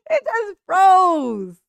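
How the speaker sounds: loud, high, drawn-out laughing exclamation; real laughing. Real, not acted. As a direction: crack up loudly while stretching the words. Laughing at man's frozen reaction.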